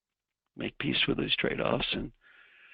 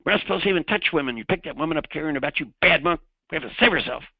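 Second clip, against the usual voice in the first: high, loud mock tirade; angry monk; 5 semitones higher, 6 dB louder than usual. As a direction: play the outraged monk telling off his companion. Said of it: "The other monk is angry".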